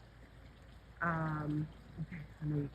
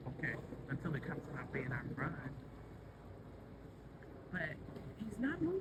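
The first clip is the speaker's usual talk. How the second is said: ordinary talk, nothing marked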